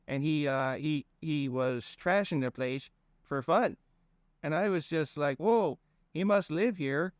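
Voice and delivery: put-on high, folksy rambling accent; goofy drawling accent. Fang explaining himself to Conrad in a goofy accent. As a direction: ramble on in a dopey regional accent. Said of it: "Ian was doing an accent".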